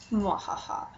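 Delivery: soft mock evil laugh; a quiet hammy villain mwahaha; mad scientist cackle. Teasing the audience about suspense.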